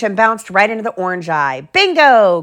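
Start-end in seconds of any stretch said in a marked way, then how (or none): 1.7-2.4 high, loud happy shout; excited cry. A narrator's reading. Ralphie cheering as the light hits. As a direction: cheer it out like a kid who won. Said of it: "cried Ralphie".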